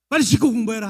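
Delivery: high, loud scold punching 빨리; harsh nag. Retold or imitated. Parent nagging child the moment they arrive, loud and high. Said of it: "하는 순간에 공부하기 싫어져서".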